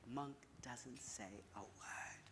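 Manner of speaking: hushed whisper, slow; whispered narration. A narrator's reading. Storyteller hushing the room before the monk acts, whispered.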